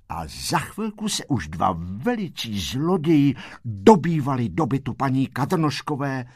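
ordinary delivery

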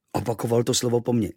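plain reading voice

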